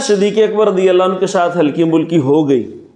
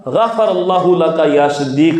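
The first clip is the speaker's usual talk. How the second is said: slow recitation; 2 semitones lower, slower than usual. Shown as a demonstration. Quoting the Arabic prayer slowly.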